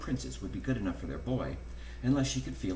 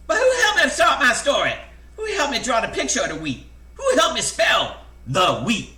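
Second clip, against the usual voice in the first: high, loud complaining hen voice; squawking hen; 13 semitones higher, 10 dB louder than usual. A narrator's reading. Hen pesters the busy author about her story, loud high squawk. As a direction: squawk it out in a high-pitched cluck.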